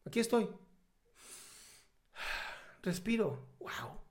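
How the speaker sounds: hushed wonder, long pauses between phrases; quiet self-discovery. Acted. Coach shows what finding yourself really is, quiet wonder.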